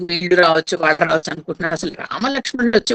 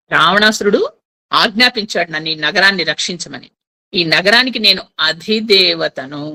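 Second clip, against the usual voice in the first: ordinary delivery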